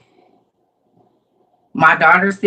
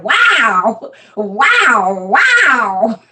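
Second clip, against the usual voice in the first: imitating a thrilled baby, each wow swooping and drawn out; excited baby grandson; 3 semitones higher, much slower than usual. Grandmother imitates her excited grandson pushing his walker, thrilled wows. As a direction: stretch every wow like an excited little one. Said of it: "He's so excited. He said".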